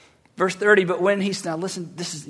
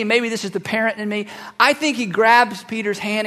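ordinary talk

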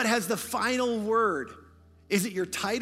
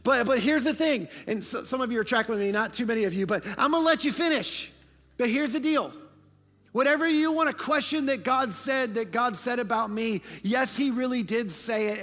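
higher, louder, rising fervour; preaching at full force; 5 semitones higher, 5 dB louder than usual. Acted. Preacher proclaiming God's promises, full force and louder. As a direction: preach it out to the congregation.

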